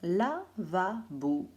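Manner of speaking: very slow, syllable by syllable chocolat; slow, stretched. Shown as a demonstration. Teacher dictating words slowly to children. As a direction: stretch each syllable of lavabo. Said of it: "teacher dictating three words".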